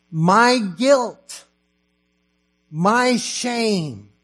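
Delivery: loud, high, fervent sermon voice, slowing on the cross lines; full-force preaching. Acted. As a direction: preach it out to the congregation, pounding each line. Preaching the gospel with rising force.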